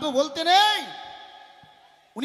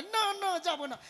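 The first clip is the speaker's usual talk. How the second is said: mimicking the daughter; 3 semitones higher, 7 dB quieter than usual. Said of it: "বলে".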